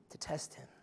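a hushed, breathy aside to the congregation; whispered aside. Real, not acted. Preacher whispering to the church about the Pharisees' trap. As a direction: drop to a whisper to let the point land.

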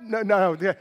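normal talking voice

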